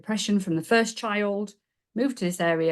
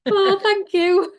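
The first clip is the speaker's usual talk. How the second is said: high, loud, drawn-out delighted squeal; delighted thanks; 13 semitones higher, 8 dB louder, slower than usual. Real, not acted. Guest thanked for a birthday wish, delighted high squeal. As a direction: gush your thanks, slowly and much higher. Host wishes her happy birthday.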